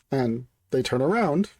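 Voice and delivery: ordinary delivery, nothing marked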